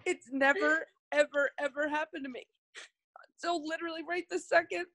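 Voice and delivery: words breaking into real tears; crying. Real, not acted. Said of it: "See how your why should make you cry".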